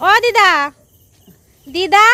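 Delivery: loud high call; to someone hard of hearing. Real, not acted. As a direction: raise the voice so she hears. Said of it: "দিদা কানে কম শোনে".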